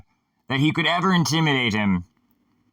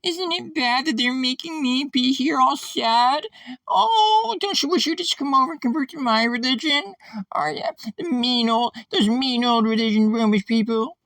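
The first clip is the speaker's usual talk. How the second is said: mocking victim voice; 14 semitones higher than usual. Speaker mimicking an acquaintance playing victim, mocking high voice. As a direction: squeak out mock self-pity to win converts. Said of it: "That's what he sounds like".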